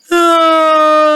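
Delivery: long, loud demonstrated yawn; big yawn. Shown as a demonstration. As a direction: yawn hugely on one held note. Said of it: "Can you yawn with me?".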